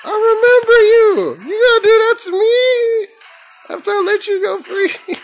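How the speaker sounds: hammed-up squeaky hurt voice; mock betrayed. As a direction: whine as if cruelly betrayed. Confronting player who betrayed him.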